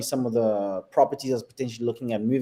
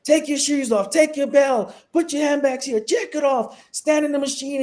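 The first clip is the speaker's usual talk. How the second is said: high, rapid-fire mock airport orders; American security officer; 12 semitones higher, 3 dB louder than usual. US airport guard herding travellers through checks, officious mock orders. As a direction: imitate an officious guard calling out commands. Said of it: "you go to America, it's like".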